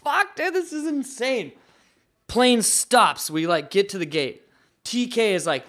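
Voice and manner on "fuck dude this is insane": much higher, hyped-up disbelief; egging his friend on, thrilled; amazed disbelief. Retold or imitated. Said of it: "I'm like".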